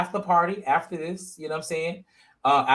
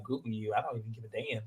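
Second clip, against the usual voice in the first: muttered under the breath, softer; dismissive mutter; 5 semitones lower, 12 dB quieter than usual. Retold or imitated. Retelling her reaction to his boast, muttered dismissively. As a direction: brush it off quietly, unimpressed. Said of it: "I was like".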